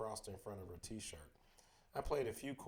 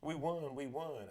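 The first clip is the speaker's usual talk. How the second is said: higher, louder victory cry; gleeful shouting; 5 semitones higher, 5 dB louder than usual. A narrator's reading. Jared and Christina behind the narrator, shouting gleefully. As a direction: cheer the win out loud. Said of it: "Jared and Christina shouting gleefully".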